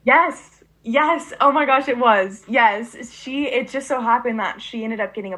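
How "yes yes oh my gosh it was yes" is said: high, loud burst of recognition; excited, emphatic yes; real delighted excitement. Real, not acted. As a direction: confirm it with thrilled surprise. Delighted recognition of shared puppy.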